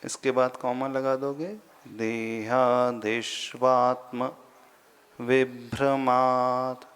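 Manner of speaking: slow, careful Sanskrit recitation with pauses; slow verse recitation. Shown as a demonstration. Sanskrit teacher recites the verse slowly for students. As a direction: chant each word slowly and deliberately. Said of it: "recites verse slowly".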